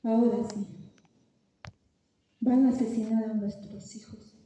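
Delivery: low, soft, despairing voice; quiet, sunken resignation; hushed dread. Retold or imitated. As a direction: say it low, as if all hope is gone. Retells her despair on seeing the cannon.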